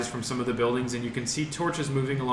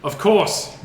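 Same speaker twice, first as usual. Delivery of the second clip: guard shouting; 9 semitones higher, 7 dB louder than usual. Acted. Guard answers from wall.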